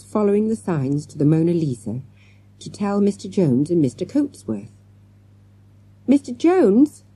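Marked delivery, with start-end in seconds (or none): 6.1-7.0 loud shout, pitched much higher. A narrator's reading. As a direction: call out across the room to the teacher. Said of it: "Morgan shouted".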